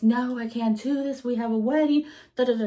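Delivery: mild mock indignation from the mom; mom protesting. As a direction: imitate the mom's insistent refusal. Retold mom refusing.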